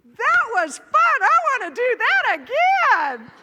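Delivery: high, loud, slow thrilled cry; elated. Retold or imitated. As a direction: gush with delight. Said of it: "And I said".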